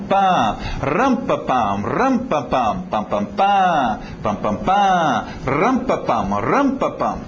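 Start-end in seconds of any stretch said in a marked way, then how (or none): none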